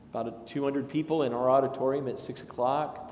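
ordinary talk, nothing marked

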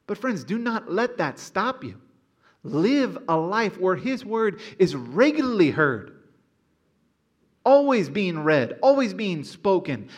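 high, loud preaching build; fervent preaching. Acted. Pastor exhorting his congregation to live in the word, fervent preaching. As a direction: urge the congregation on at full force.

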